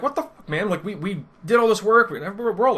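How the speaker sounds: high, loud mock outrage; losing it. Players cheated of their reward, acting out high-pitched outrage. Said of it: "And, like, we lose it".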